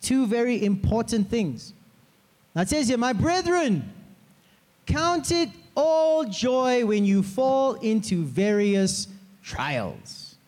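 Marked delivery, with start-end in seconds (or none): 3.0-10.3 scripture reading. Acted.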